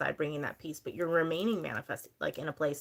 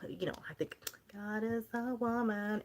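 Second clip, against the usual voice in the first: whisper; 4 semitones higher, 6 dB quieter than usual. Real, not acted. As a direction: lean in and whisper it like a secret. A playful aside to viewers.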